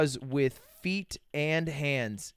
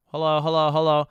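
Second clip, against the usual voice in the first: louder hellos so the mic picks them up; loud mic check; 6 dB louder than usual. Real, not acted. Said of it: "Your mic's quiet?".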